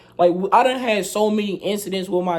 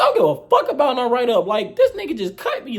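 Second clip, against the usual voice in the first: defiant; 7 semitones higher, 3 dB louder than usual. Retold or imitated. Defying teacher after being cut.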